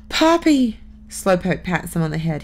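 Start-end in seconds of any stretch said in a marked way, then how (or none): 0.1-0.8 high, loud cry of alarm; scared boy calling out; frightened cry. Acted. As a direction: cry out for his grandpa in panic. Kermit fears for grandfather.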